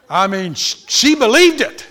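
loud, high, driving sermon voice; preaching. Acted. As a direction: hammer the message home to the congregation. Preaches the point at full force.

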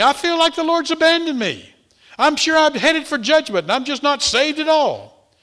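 high, loud mock-anguished complaint; acted distress. Church member telling pastor she feels abandoned, acted distress. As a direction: lament it as a despairing church member.

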